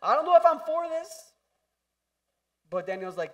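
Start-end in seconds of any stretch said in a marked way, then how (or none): 0.0-1.3 high, loud hesitant whine; whiny mock friend. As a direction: play a reluctant buddy craving ribs. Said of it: "I'd be like".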